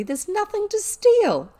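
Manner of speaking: high, loud, drawn-out cheerful line; cheery girl's voice, swooping up. A narrator's reading. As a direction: sing out brightly to reassure a friend. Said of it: "Sarah cheerfully".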